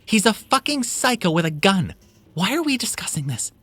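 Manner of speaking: high, loud, frantic; scared and furious; panicked shouting. Acted. Mia panicking at the group.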